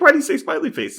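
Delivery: very high, loud incredulous squeal; exaggerated high-pitched bafflement; mock bewildered question. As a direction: shoot the voice up in comic disbelief. Acted bewilderment at smiley threat.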